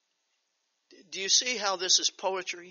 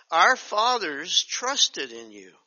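slow solemn rereading; slow scripture reading; 5 dB louder, slower than usual. Acted. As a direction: read it again, weighty and slow. Rereads same verse.

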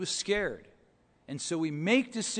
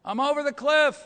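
even louder, high frantic yells; yelling louder; 8 semitones higher, 7 dB louder, slower than usual. Retold or imitated. Man hanging below the cliff, yelling louder for help. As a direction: scream for rescue with everything left. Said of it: "He yells again, louder".

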